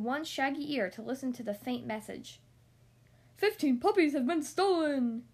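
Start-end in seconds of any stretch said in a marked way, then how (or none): 3.4-5.3 louder alarmed cry; old sheepdog crying out the news. A narrator's reading. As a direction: call out the bad news with alarm. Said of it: "he cried".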